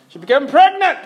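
PREGNANT rising high and loud, stress on pregnant; excited announcement. Real, not acted. Preacher announcing friend's late baby, excited stress on pregnant.

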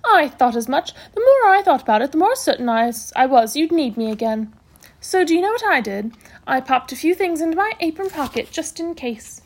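animated matron with big pitch swoops; brisk matron, self-satisfied. Acted. Matron telling the boys she came prepared, self-satisfied. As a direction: play a pleased, knowing matron.

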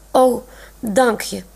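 slow, choked thanks; hoarse voice. A narrator's reading. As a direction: thank her with a catch in the throat. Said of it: "zegt Olaf met een heese stem". (hees en traag)

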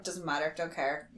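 low, soft brush-off; dismissive brain. Acted. Her brain tuning out the cold open, quietly dismissive. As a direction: voice an indifferent brain, quietly dropping away. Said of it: "my m-, brain is like".

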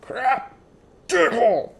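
high, strained string of curses; hammed-up hurt yelps, one word at a time; mock pain swearing. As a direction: imitate someone swearing through pain. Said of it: "all make different yells when we fall and hurt ourselves".

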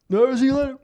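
mumbling deaf man. Retold or imitated. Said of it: "mumbled something".

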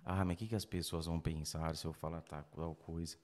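softly, worried self-talk; quiet doubting voice. Retold or imitated. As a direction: murmur the worry to yourself. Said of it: "fica só ali remoendo". (voz baixa e insegura)

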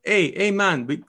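a quick, clumsy stab at an Italian pizza guy that comes out Jamaican; hammed-up Italian accent gone Jamaican; botched Italian accent. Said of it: "I just said in Jamaican voice. I was trying to do Italian".

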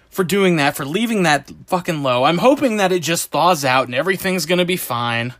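ordinary talk, nothing marked

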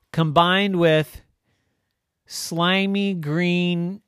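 drawn-out loud, savoring each stink; slow relish of gross details; slow gross-out build. Acted. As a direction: linger on every disgusting item.

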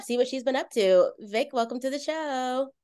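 ordinary talk

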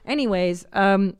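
normal talking voice

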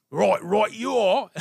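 high, loud mock British reply; English accent. Host joins the co-host's bit, playful mock English accent. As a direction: answer in a hammed-up British voice, amused. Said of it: "all you hire is English actors".

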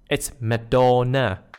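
"Madonna" with no Z, the local way; local Manglish pronunciation. Shown as a demonstration. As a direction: say McDonald's as Madonna, slow and firm. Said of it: "that's not how the local says it".

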